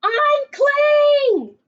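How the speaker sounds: high, loud shout from below; a cheering crowd yelling; crowd calling out. Acted. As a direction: call up to him from the crowd. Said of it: "the crowd called".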